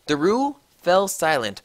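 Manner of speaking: ordinary delivery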